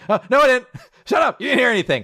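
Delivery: high, loud panicky backpedal; hammed-up embarrassed protest; flustered denial. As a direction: deny it frantically, as if caught out.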